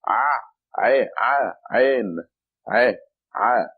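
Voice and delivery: Ah and Ain pressed hard from the throat; exaggerated throaty Ain sound. Shown as a demonstration. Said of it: "I'm trying to make as much sound".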